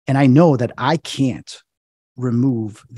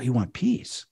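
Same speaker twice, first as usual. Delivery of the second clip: softer, questioning echo; retold quiet echo; 6 dB quieter, slower than usual. Exorcist checking what the demon wants, quiet echo. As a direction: repeat it back softly as a question.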